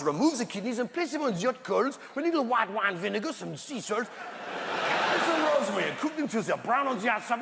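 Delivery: French chef savouring a recipe; comic French accent, relishing each step; hammed-up French gourmet accent. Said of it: "in a French accent, for instance".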